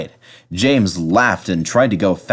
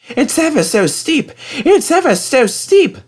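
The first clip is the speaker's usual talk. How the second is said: high, straining engine voice; high huffing complaint on a steep hill; James puffing uphill; 4 dB louder than usual. A narrator's reading. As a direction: puff it out, climbing hard. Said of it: "puffed James".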